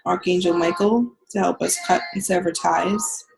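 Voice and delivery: calm guided healing meditation. Acted. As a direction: ease them into the visualization. Leads a guided visualization.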